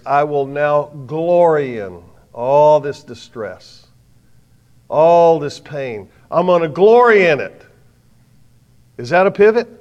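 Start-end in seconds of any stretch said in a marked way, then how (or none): 0.0-6.1 slow, drawn-out and louder, leaning on glory; slow emphatic vow. Acted. Preacher voicing Paul, slow and swelling with resolve. Preacher stretching Paul's words. 6.3-7.6 fervent declaration. Acted. Preacher voicing Paul's vow.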